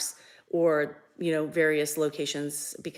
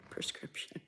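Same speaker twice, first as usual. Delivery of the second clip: breathy laugh under the words; soft laughing correction; laughing whisper; 15 dB quieter than usual. Real, not acted. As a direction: whisper the correction through a giggle. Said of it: "not subscription".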